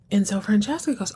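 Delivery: usual speaking voice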